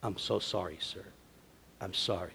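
low, hushed, soothing apology; soft word. Retold or imitated. Supervisor apologizing to calm the wrath, softly. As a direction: imitate a gentle apology, well down in pitch. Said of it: "when people speak with a soft word".

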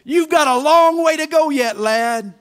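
loud, high barked order; gruff boss shouting at the young man. Retold or imitated. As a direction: call it out loud across the yard. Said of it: "his former boss shouts at him".

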